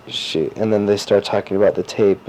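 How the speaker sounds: normal talking voice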